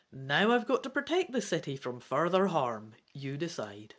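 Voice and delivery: ordinary delivery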